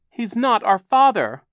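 put-on high, loud, outraged child; shouted denial. A narrator's reading. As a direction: shout it in protest. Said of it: "Klaus shouted".

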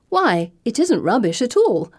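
high, put-on earnestness; mock sincerity. A narrator's reading. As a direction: pretend to be wholly sincere. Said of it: "I say with mock sincerity".

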